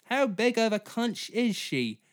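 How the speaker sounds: a greedy star choosing her next queen; mock actress. Voices Colman asking.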